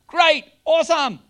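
high, loud mock excitement; hammed-up delighted diner. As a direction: gush like a thrilled diner. Mock diner delight at free meal.

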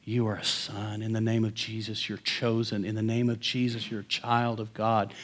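soft, low hush into an ear; quiet reassuring murmur; retold whisper. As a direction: murmur it close, like a secret blessing. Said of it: "I'd whisper into his ear".

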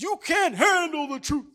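high, loud snarling movie quote; Jack Nicholson's famous line. Retold or imitated. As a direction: blast it like Nicholson on the stand. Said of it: "He says".

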